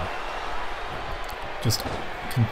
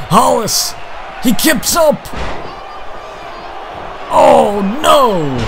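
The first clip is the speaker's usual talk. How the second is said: excited shout; 11 semitones higher, slower than usual. Real, not acted. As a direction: build into a big shocked yell. Wrestler kips up, live call.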